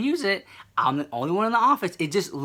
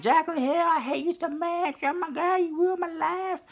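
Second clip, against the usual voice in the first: high, mock-dramatic whiny fan voice; imitating overdramatic fans in a high whine; mocking upset fans; 12 semitones higher, 4 dB quieter than usual. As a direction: parody heartbroken fans, pitched way up. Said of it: "a group of people who are just like".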